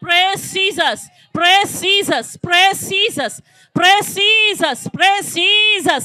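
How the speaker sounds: each word stretched out very slowly; slow praise. Real, not acted. Preacher calling out praise over the congregation, very slow. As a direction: draw out every praise Jesus.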